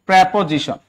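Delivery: প্রিপোজিশন said as "pre-po-jishon"; teacher models প্রিপোজিশন as "prepojishon"; correct pronunciation. Shown as a demonstration. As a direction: sound the r and open e in প্রিপোজিশন, "pre". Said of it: "এটা উচ্চারণ কি?".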